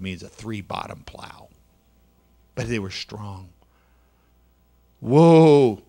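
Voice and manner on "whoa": loud call to halt the mules; calling whoa. A narrator's reading. Farmer calling his mules to stop, a loud call. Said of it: "Mister Stevens said that day as he tugged on the reins".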